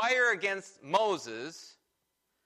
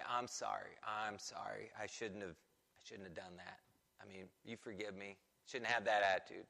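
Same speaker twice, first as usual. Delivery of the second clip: much softer and lower, head-down sorry; sheepish mumbled apology; 10 semitones lower, 11 dB quieter than usual. Retold or imitated. Teen mumbling sorry to mom, much softer and sheepish. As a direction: mumble it like a guilty kid.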